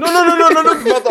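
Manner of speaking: laughing protest. Real, not acted. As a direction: wave it off while cracking up.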